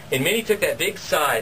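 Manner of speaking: ordinary delivery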